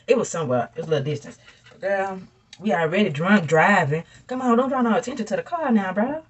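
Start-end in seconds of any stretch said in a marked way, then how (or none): 4.3-6.2 urgent plea. Retold or imitated. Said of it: "urging brother-in-law, drunk driving".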